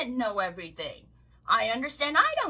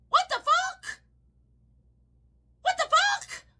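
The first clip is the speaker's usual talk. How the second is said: high, squeaky what the fuck; can't believe what you're seeing; stunned disbelief; 4 dB louder than usual. Real, not acted. Reacting to minorities backing Trump, high stunned disbelief.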